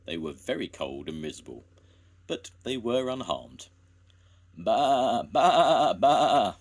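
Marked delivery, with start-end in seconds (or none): 4.5-6.6 loud, high bleating; shouting sheep. A narrator's reading. Fourteen sheep shouting at Jones, loud high bleats. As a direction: bleat out like a flock. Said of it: "they shouted".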